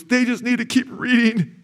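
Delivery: very high, strained crying voice; imitated tearful voice. Preacher quotes his sobbing friend on Calvinists, imitated tearful voice. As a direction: mimic a man wailing it through tears. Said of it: "and he's crying".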